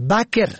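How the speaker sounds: بکر with "a" after the ب, "baker"; first vowel fatha. Shown as a demonstration. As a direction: say بکر as BA-ker. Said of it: "به فتح اول".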